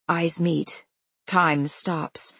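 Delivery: usual speaking voice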